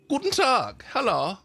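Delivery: high, bright-voiced German greeting; lightly accented stranger saying hello; cheery German stranger. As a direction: greet them like a friendly naked German hermit.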